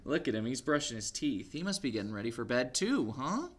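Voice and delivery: lively picture-book read-aloud; story reading to children. A narrator's reading. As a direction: read it to little ones.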